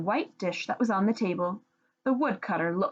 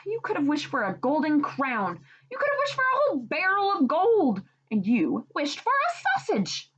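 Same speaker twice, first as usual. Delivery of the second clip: scolding; 8 semitones higher than usual. Acted. Wife scolding husband.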